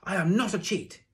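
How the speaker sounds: high, loud, furious denial; shouted back. A narrator's reading. Boy defying his teacher, shouted in fury. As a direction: blind fury bursting out. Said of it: "I shouted back at him".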